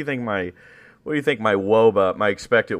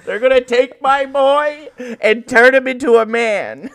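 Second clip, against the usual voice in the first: much higher, slow proud-mother voice; mock mom; 13 semitones higher, 6 dB louder than usual. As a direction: dote like a mother at a ceremony.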